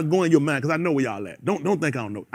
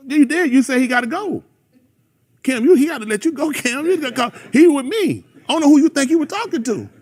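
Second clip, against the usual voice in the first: raised, higher confronting voice; heated confrontation; 9 semitones higher, 5 dB louder than usual. Real, not acted. Preacher confronting someone who said Cam must go, heated. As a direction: challenge them loudly from the pulpit.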